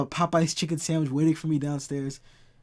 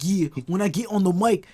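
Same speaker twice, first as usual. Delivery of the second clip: loud, raised-pitch British rap mimicry; mock British rapper; 4 semitones higher, 7 dB louder than usual. American mocking UK rap to a British friend, mock British accent. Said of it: "it just sounds silly".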